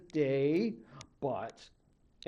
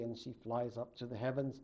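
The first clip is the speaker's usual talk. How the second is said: plain reading voice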